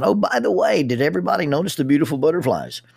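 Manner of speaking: high, fast, sing-song mockery of the announcer; hammed-up perky camp announcer; mock cheery announcement. Mocking the whistle guy's announcements.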